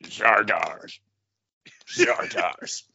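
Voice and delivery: low, slow moody model voice; deep photo-shoot pout; Grizzlor posing. Retold or imitated. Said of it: "close-ups going in of Grizzlor going".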